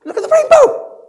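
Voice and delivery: very high, loud squeal of wonder; mock-excited exclamation. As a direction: cry out in hammed-up amazement. Acts out marvelling at a rainbow.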